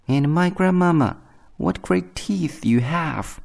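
put-on high girlish voice, slowing down; little girl's high, slowing exclamation. A narrator's reading. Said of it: "said the little girl".

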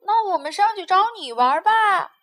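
loud, high call up the tree. A narrator's reading. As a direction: shout up, eager to join. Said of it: "朋友们又喊". (朋友们兴奋地大声喊)

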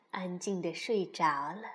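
plain reading voice

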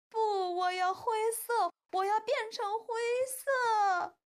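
high, loud wailing tantrum; child's loud crying protest; bawling. A narrator's reading. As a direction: howl the demand, dragging out the last word. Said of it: "哈瓦尔嚎啕大哭起来".

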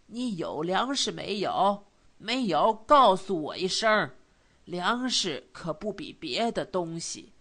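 put-on kindly insistent old man's voice; concerned old neighbour. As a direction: urge an old friend earnestly, with lively swings. (老邻居关切而恳切的口吻)

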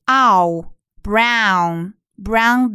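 slow "brown" with a full ow vowel; OW sound shown. Shown as a demonstration. Teacher shows the OW in brown, slowly drawn out. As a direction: draw out the ow in "brown". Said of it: "它的发音是".

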